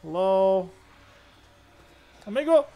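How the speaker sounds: high loud calling out; taunting call. Pretend. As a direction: yell out to lure them. Calling out to enemies.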